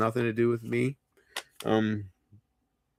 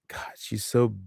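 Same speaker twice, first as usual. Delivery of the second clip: ordinary delivery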